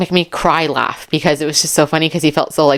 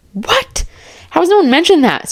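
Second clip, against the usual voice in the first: shocked disbelief; 12 semitones higher than usual. Real, not acted. Shocked by text message.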